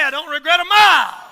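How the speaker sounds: preacher shouting to the church; preaching shout. Real, not acted. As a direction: cry it out over the congregation. Preaching climax to the congregation.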